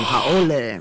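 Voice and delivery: usual speaking voice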